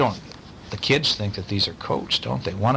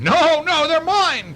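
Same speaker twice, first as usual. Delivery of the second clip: put-on high loud child scream; 5 dB louder, slower than usual. A narrator's reading. Child refusing to share spurs, screaming. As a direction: shriek it, possessive. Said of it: "Craig screamed".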